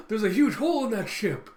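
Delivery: high, loud gasp of disbelief; acted alarm. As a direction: cry out in shock at the damage. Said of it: "And they're like".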